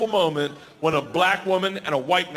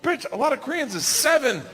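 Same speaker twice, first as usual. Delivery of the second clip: much higher, pitched-up disbelief; incredulous rant; 9 semitones higher than usual. Retold or imitated. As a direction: squeal out his outrage at the crowd. He rants about the Korean crowd.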